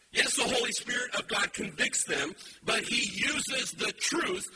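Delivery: loud, rising preacher's call; fiery sermon voice; full-force preaching. Acted. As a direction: drive it home to the congregation.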